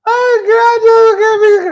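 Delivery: high, loud, laughing through the words; laughing hard. Real, not acted. Mocking the friends while laughing hard.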